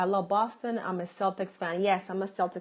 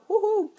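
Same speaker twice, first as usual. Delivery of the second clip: high, loud whoop; celebratory high-pitched woohoo; proud cheer; 12 semitones higher, 6 dB louder than usual. Real, not acted. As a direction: whoop with pride. Proud cheer about her origins.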